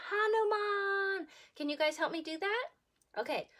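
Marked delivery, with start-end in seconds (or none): 0.1-1.3 slow higher call of the name; demo shout. Shown as a demonstration. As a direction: show the kids how to call. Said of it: "go like this and shout".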